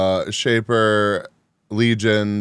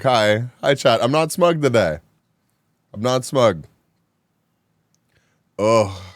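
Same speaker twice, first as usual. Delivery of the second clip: ordinary delivery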